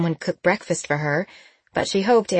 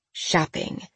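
whispered; 6 dB quieter than usual. A narrator's reading. The magic word.

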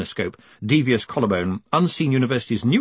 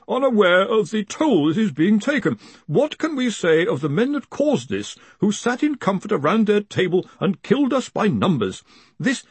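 high-pitched voice unlike the narrator's; professor reading out; 10 semitones higher than usual. A narrator's reading. Professor reads out the accusation, high dogged reading. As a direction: read it out high, pressing doggedly on. Collarbone reads a message aloud.

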